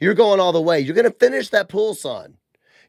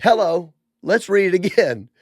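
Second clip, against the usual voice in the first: ordinary talk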